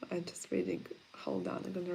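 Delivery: whispered aside while reading. Real, not acted. Streamer whispering to herself while reading chat comments. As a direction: murmur it to yourself under the breath.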